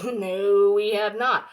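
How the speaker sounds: low, drawn-out monotone reply; mock designers' denial. Shot designers answering whether they got it, droning. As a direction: drone a deadpan denial, pitched low. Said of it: "They're like".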